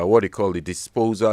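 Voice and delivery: normal talking voice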